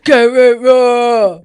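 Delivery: loud slow roar. Pretend. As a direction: roar it out raw. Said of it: "I just roared".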